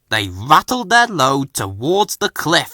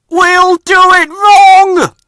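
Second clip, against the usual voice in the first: much higher, much louder, slow defiant shout; 11 dB louder, slower than usual. A narrator's reading. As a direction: shout the rebellious plan. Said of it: "Ben said".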